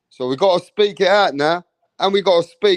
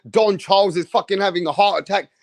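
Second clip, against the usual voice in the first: ordinary delivery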